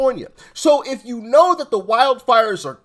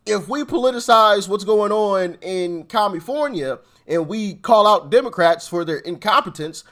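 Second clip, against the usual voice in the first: usual speaking voice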